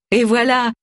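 high, cheerful exclamation; high, delighted ta-da; merry cry. A narrator's reading. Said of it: "s'écria Tony en riant".

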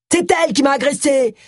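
high-pitched, indignant protest; acted yell. A narrator's reading. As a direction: yell the accusation back in outrage. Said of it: "hurla l'agresseur".

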